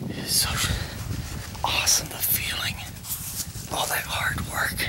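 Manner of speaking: hushed, breathy hunting whisper; excited hunter whisper. Real, not acted. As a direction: whisper it so the deer can't hear. Hunter whispering during hunt.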